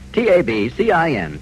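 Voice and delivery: ordinary delivery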